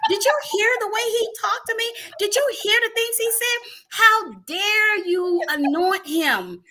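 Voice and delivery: indignant outcry. Retold or imitated. Wife telling God off for anointing her quarrelling husband, high outrage. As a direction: fling it at God in disbelief, slowing on the last line. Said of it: "I was so upset".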